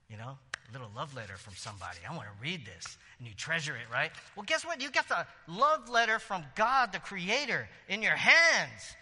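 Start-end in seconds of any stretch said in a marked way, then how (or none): 4.4-9.0 excited build. Real, not acted. Preacher builds to God's love letter.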